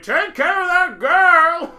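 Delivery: high, loud, slow admiral's command; barked order. Retold or imitated. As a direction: bellow it like an old naval officer. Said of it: "Admiral Boone even is like".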